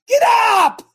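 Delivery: very high, full-throated shout; explosive drill-like rallying yell; exhorting. Shown as a demonstration. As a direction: bellow it to rouse them. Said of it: "I'm exhorting you".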